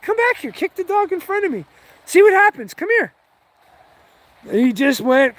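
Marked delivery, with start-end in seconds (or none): 0.0-3.1 high, challenging call after him; retold taunting yell. Calling after the retreating roommate, a high taunting yell. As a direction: goad him to come back and try. Daring roommate to kick dog.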